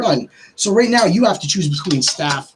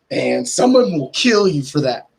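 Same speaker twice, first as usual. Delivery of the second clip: normal talking voice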